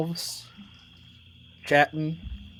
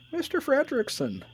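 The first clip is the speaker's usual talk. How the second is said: put-on old man voice; 13 semitones higher, 6 dB quieter than usual. GM voices Up character.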